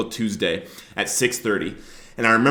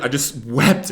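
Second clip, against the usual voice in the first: ordinary talk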